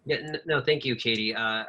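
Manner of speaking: ordinary talk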